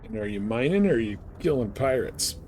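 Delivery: ordinary talk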